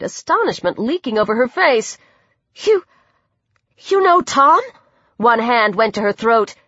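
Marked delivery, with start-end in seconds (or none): 2.6-4.6 halting, high-pitched question; falter, then ask in amazement; stunned, hesitant query; astonished. A narrator's reading. Girl halted in astonishment.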